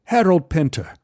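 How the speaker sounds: a polite English gent's greeting; English accent. Retold or imitated. English stranger greets narrator at the bar, lightly English. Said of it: "He, he was English".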